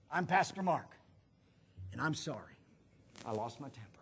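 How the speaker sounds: sinking low and soft; contrite apology. Retold or imitated. Pastor apologizing to stopped traffic, low and humbled. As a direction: confess it quietly, dropping in pitch and volume. Acts his public apology.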